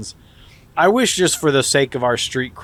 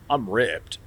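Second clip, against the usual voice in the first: Chris Evans impression; 5 semitones lower, 8 dB quieter than usual. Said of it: "Chris Evans impression".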